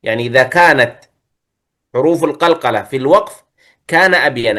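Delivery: ordinary talk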